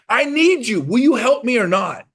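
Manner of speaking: mock urgent plea. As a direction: beg him to help, hard.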